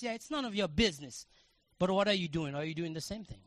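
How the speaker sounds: normal talking voice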